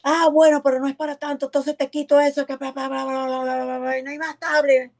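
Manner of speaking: mock the father waving it all away with bla bla bla; preacher mimicking an annoyed father who takes the tablet; exasperated father. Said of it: "responde / sale el papá".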